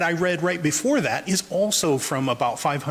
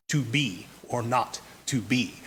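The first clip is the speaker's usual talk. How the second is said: low, measured "to BE or NOT to BE"; iambic stress; 3 semitones lower, 4 dB quieter than usual. Shown as a demonstration. Lecturer illustrating iambic meter, weak-strong "to BE or NOT to BE". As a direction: lean on every second word: "to BE or NOT to BE". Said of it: "That's iambic".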